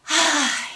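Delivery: contented waking-up sigh; refreshed sigh. Acted. Peddler wakes from a long nap, refreshed sigh. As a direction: let out a long, rested "ahh".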